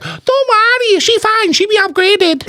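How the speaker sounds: very high, sweet mock voice; imitating the manager. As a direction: play the manager in a high sing-song. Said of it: "she was like".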